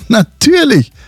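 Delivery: high, drawn-out boast; mock proud. Husband showing off his dance move to Susi, mock proud. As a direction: play up the pride, stretching the words. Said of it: "Ich sagte ganz stolz".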